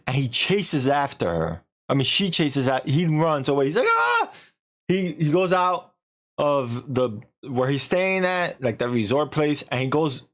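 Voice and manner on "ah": high-pitched short scream of fright; imitated fleeing yelp; mock scream. He runs away from her.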